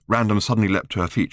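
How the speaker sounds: ordinary delivery, nothing marked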